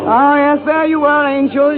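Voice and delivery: usual speaking voice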